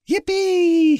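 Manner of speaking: high, loud cowboy whoop; excited cry. A narrator's reading. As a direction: let out a shrill-pitched, gleeful yippee. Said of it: "he cried".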